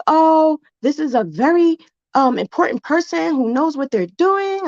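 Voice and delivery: high, mocking put-on voice of the customer; a lightly mocking impression of an indignant customer; imitating the customer. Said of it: "She was like".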